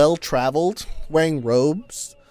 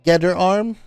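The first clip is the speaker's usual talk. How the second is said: normal talking voice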